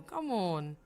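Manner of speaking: normal talking voice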